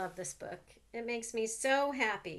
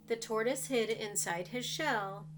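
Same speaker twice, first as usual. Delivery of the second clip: ordinary delivery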